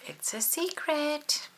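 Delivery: slow, high secret tone; slow high mystery. A narrator's reading. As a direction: tease the secret. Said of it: "title again".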